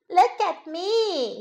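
little bunny calling, high and drawn out. A narrator's reading. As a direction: call for mother's attention, slow and high. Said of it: "he called".

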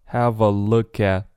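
"have a look at" slowly run together; linked words. Shown as a demonstration. Teacher demonstrating for learners, slow linked "have a look at". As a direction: join "have a" and "look at" as "havva lookat". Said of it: "have a连起来".

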